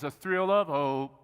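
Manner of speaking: slow, lilting recital of a carol verse; a slowed, reverent reading of O Holy Night; recited hymn lyric. Shown as a demonstration. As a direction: speak the hymn words slowly like a prayer. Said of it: "You know the verse that says".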